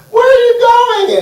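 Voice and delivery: shrill, loud mock wail of a child; hammed-up, very high whiny cry. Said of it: "They don't cry".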